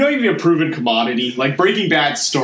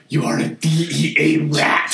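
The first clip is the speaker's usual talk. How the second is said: rude villain voice. Retold or imitated. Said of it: "be like".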